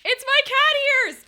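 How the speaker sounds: very high, loud, slow cry of joy; ecstatic squeal. Real, not acted. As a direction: squeal it out, overjoyed. Finds the wanted cat ears.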